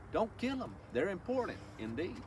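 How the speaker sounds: usual speaking voice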